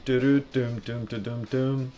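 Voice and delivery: ordinary talk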